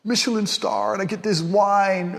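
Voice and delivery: high-pitched mocking show-off voice; pompous loser. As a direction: gush affectedly like a pretentious diner. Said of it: "pompous losers who go".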